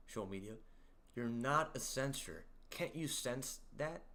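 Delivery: normal talking voice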